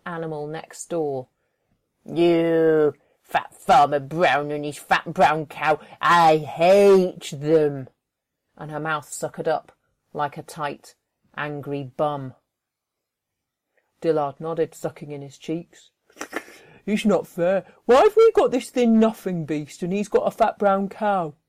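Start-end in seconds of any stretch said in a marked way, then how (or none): 2.0-7.9 slow, loud bitter voice; spiteful Joy. Acted. Joy hating the neighbour and his fat cow, slow and loud. 16.9-21.3 loud sulky complaint; envious Dillard. Acted. Dillard complaining to Joy about the neighbour's cow, sulky.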